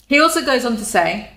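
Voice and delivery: normal talking voice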